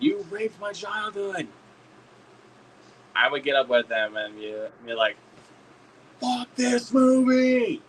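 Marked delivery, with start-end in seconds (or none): 0.0-1.5 high, drawn-out mock wail; mock outraged cry. Moviegoer protesting in the theater, mock outraged cry. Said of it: "people were saying". 6.2-7.8 high, long drawn-out heckle; mock yell. Moviegoer standing up to heckle the film, mock yell. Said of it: "I will yell that out".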